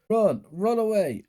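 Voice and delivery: a little sister jeering as he flees; mock screaming taunt. A narrator's reading. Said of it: "Mary was screaming".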